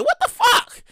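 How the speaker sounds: seeing a long line at dawn in high disbelief; high-pitched disbelief. Retold or imitated. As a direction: yelp in disbelief. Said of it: "I'm like".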